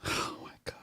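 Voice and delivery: stunned whispered aside. Real, not acted. Viewer stunned by the speech, whispering. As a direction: breathe it out in disbelief.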